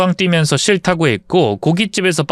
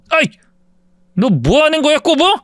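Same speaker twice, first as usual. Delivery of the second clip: shocked outrage; 11 semitones higher than usual. Acted. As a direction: pitch jumps high on the insult. Bully punched, shouts at narrator.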